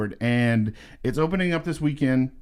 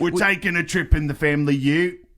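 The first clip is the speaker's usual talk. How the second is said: hammed-up Aussie accent, pitched up; Australian accent; 5 semitones higher than usual. Shown as a demonstration. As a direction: demo a put-on Australian accent. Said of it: "working on my Australian accent".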